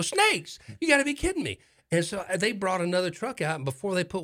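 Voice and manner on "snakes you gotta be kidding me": much higher, outraged disbelief; telling the firemen off in high disbelief; incredulous. Retold or imitated. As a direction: squeak it out as if you can't believe it. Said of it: "I'm going".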